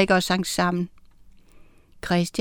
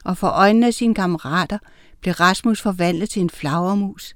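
plain reading voice

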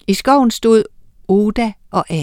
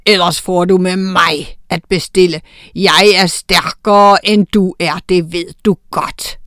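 threatening troll; 5 dB louder than usual. A narrator's reading. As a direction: warn her you're the stronger one.

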